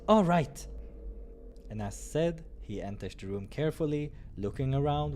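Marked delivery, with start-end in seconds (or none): 0.1-0.7 high loud quick answer; bright loud agreement; higher eager reply. Acted. Narrator voices companion reply.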